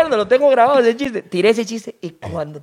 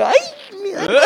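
chillido agudo y meloso de enamorado; imitando pareja cariñosa; 12 semitones higher than usual. Retold or imitated. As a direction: imita a la pareja besuqueándose, exagerado. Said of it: "Y yo así como que". (imitación meliflua de pareja)

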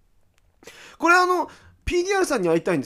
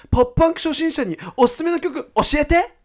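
high, louder put-on reading voice; eager listener question; 11 semitones higher, 5 dB louder than usual. Host reading a pop-punk beginner's question, high and eager. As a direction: read it as an eager beginner's request.

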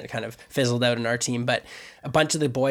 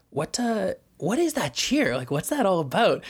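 lightly voiced young girl, higher and puzzled; curious girl; 7 semitones higher than usual. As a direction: ask it like a curious kid. Voicing girls asking about cheer.

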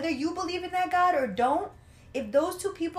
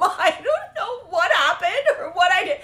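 louder, laughing imitation of crying; mock crying voice; 5 semitones higher, 7 dB louder than usual. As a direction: imitate her sobbing through laughter. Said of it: "she started crying".

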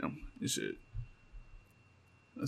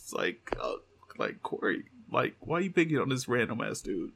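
ordinary delivery

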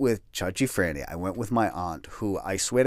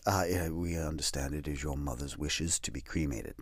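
hushed staff voice; 7 semitones lower, 10 dB quieter than usual. Retold or imitated. Funeral staff, soft and hushed.

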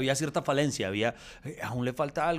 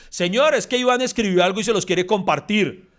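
louder and higher announcement to the boss; 9 semitones higher, 12 dB louder than usual. Retold or imitated. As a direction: call it out boldly for the whole room. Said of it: "lo dice en voz alta".

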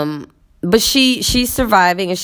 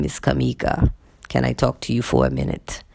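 lower, softer mimicked man's voice; imitating male colleague; 5 semitones lower, 6 dB quieter than usual. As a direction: put on his low, quiet way of speaking. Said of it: "That's how he says my name".

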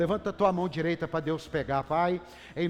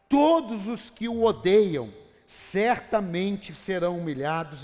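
booming, slow proclamation, much louder; preaching at full force; 5 semitones higher, 10 dB louder than usual. Acted. Pastor proclaiming God's promise to the congregation, booming and slow. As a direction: thunder God's promises over the church.

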